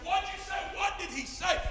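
high-pitched, insistent repeat; urgent questioning. Retold or imitated. Preacher begs translator for the answer, urgently. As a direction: press again, voice pitched right up.

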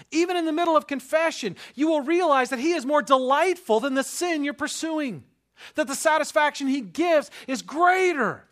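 high, loud, driving sermon voice; fervent preaching. Acted. Pastor preaching hard to his congregation, fervent and loud. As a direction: preach it out with full fire.

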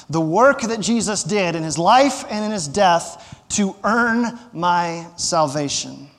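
normal talking voice

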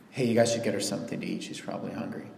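a light, caring afterthought; casual caring aside. Retold or imitated. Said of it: "Jesus says".

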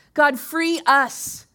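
loud plea. Real, not acted. Prayer cry.

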